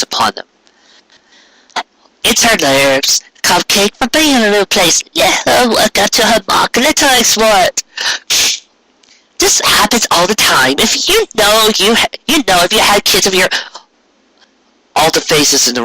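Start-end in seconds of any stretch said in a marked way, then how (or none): 2.2-13.5 high, know-it-all mare giving advice; bossy older sister. Acted. Older sister lecturing Cupcake at dinner, high and know-it-all. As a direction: pitch up and lecture like the sister who knows best.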